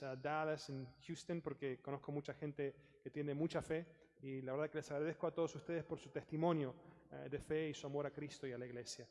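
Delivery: ordinary delivery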